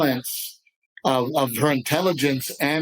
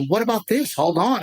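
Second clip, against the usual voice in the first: wife's objection; 6 semitones higher than usual. Retold or imitated. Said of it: "And she goes".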